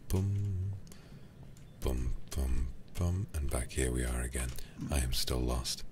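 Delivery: ordinary talk, nothing marked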